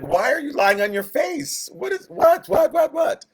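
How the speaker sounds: a God who can't believe what he sees; baffled God. Retold or imitated. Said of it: "that God's like".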